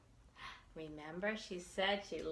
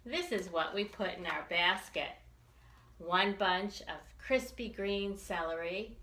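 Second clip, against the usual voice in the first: usual speaking voice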